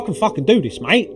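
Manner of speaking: high, animated protest; rising, worked-up self-persuasion; defiant insistence. Retold or imitated. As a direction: protest loudly that you can manage it. Retelling insistence on carrying on.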